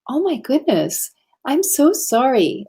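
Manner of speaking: retold gushing apology, slowing down; apologetic. Retold apology to police officer.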